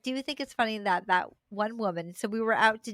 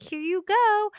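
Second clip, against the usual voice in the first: put-on high, louder, eager little-kid offer; sweet obliging toddler; 10 semitones higher, 5 dB louder than usual. Toddler handing over what he grabbed, sweetly high and eager. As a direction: hand it over like an agreeable toddler.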